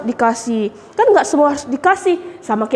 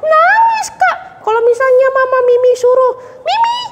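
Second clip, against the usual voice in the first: put-on high little girl telling a story; puppet girl Mimi; 13 semitones higher than usual. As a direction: chatter like a small child. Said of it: "Mimi ... nangis nggak?".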